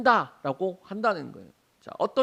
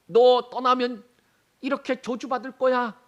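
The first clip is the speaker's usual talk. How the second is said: higher, emphatic warning, loud at the start; raised-pitch warning in God's role; mock threat; 6 semitones higher, 5 dB louder than usual. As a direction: act out a stern ultimatum. Voices God threatening a curse.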